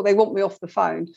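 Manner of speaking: usual speaking voice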